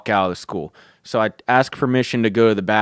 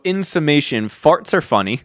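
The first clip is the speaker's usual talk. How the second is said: ordinary talk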